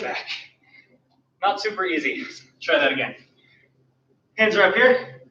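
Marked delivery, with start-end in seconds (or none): none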